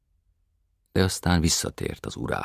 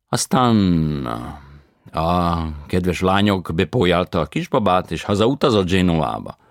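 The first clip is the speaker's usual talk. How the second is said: foreign tenor voice; 4 semitones higher, 9 dB louder than usual. A narrator's reading. Tenor answers.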